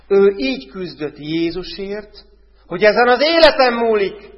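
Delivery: fervent crescendo on the woman's struggle; preaching climax. Real, not acted.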